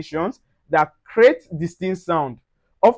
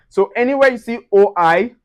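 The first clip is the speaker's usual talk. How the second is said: ordinary delivery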